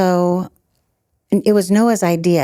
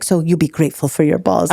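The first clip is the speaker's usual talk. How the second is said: put-on Luca's Tunisian accent. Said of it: "he knows I do his accent".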